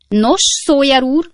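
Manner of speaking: high, loud, sharp landlady; piercing, impatient call; shrieking. A narrator's reading. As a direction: shriek it down at the lodger. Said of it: "sivított Redlné éles hangja".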